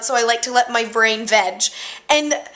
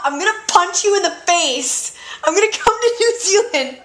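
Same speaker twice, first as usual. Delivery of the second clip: higher, mock-angry threat; playful threat; 6 semitones higher than usual. Threatening the friend in New Zealand, mock angry.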